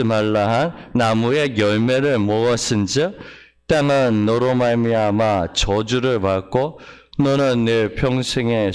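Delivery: solemn scripture recital; scripture reading. Acted. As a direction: read the verses like holy writ, steady. Reads Genesis passage aloud.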